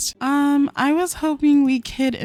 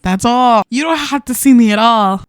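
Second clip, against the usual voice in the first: louder, pushing hard to convince him; mock-dramatic louder plea to her lover; insistent plea; 8 dB louder than usual. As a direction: press the point with force. Voicing Sim pleading with lover.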